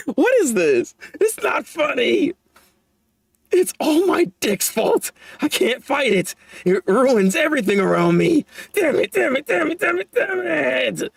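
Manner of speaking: mock-tearful rant pitched high and slow; high self-pitying whine. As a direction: curse your own weakness in a wailing character voice. Reading the character's self-pitying rant.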